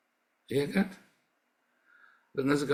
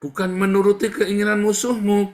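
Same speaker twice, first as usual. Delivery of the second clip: much higher, louder, with menuruti thundered out; preaching shout; 9 semitones higher, 7 dB louder than usual. Shown as a demonstration. Pastor repeating the warning to the congregation, shouting it. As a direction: thunder out bukan menuruti as a rebuke. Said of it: "Beda lagi itu ya".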